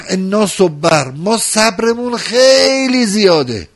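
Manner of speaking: high, fast and fervent, rising to a shout; climactic preaching. Acted. Preacher to mourners on the martyrs' patience, high fervent shout. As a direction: push the voice up and drive through the lines.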